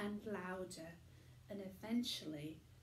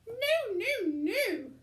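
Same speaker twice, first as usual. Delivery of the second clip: very high, loud sharp refusal; snapped; 13 dB louder, slower than usual. A narrator's reading. Mother snapping at the tramp on live TV, very high and loud. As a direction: snap it shut fast. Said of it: "snapped Mother".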